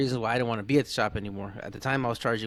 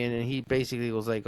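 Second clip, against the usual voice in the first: ordinary talk, nothing marked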